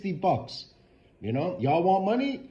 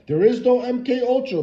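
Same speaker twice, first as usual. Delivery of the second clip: louder, higher sarcastic parody of the doubters; sarcastic mock claim; 7 semitones higher, 7 dB louder than usual. Ranting vlogger parodies people who deny gang stalking, sarcastic and loud. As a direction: throw the skeptics' line back in a mocking voice. Mocking what skeptics claim.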